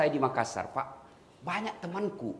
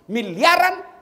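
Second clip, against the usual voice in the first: "miliaran" punched higher and much louder; stress on miliaran; 7 semitones higher, 10 dB louder, slower than usual. Acted. Preacher stressing how many billions parents spend, with a loud punch. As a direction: hit "miliaran" hard.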